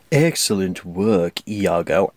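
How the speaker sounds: low, slick villain purr; a hint of Jafar's oily menace. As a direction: drip fake sympathy like a slimy villain. Jafar praising his parrot.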